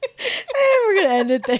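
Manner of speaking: high-pitched, laughing hard; helpless giggly wrap-up. Real, not acted. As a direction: barely get it out through laughter. Cracks up at co-host's slip.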